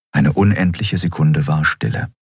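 ordinary delivery

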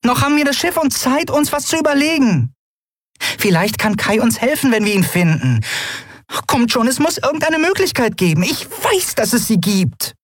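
very high, pleading encouragement; rally them desperately in a tiny high voice; high-pitched, insistent hope; earnest small heroine. Acted.

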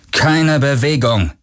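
high, drawn-out order; slow, high-pitched command; commanding warning. A narrator's reading. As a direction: order them to freeze. Armed man orders captives to freeze.

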